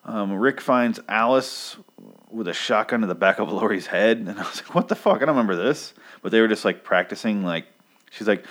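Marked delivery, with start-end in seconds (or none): 4.7-5.9 high, incredulous outburst; mock bafflement. Reader reacting to a forgotten plot twist, mock baffled. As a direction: act out a confused double take, pitched way up.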